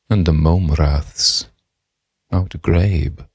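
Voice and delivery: slow, grand elocution reading of the poem; posh poem recital. Acted. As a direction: declaim it like a posh reciter, savouring every word. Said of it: "I can stop talking posh now".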